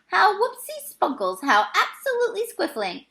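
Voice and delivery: high, delighted giant gushing; overjoyed giant praising himself; beaming delight. Acted. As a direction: gush with pure joy. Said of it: "still beaming".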